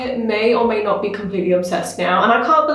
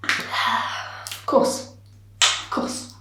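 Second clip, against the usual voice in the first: exasperated whisper; 6 dB quieter, much slower than usual. Real, not acted. Despairing at the shampoo mess.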